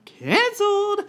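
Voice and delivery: "Canceled!" yelled very high and loud; mock shouted verdict. Wife overruling her husband, shouting "Canceled!". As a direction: bellow "Canceled!" like a final ruling. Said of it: "And then I'd be like".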